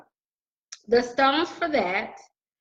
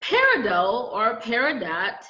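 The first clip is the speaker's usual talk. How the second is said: ordinary talk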